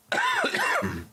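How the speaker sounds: hard sick cough; coughing fit. Real, not acted. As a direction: cough sharply like you've got a cold. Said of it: "Sorry for coughing. I've been sick too".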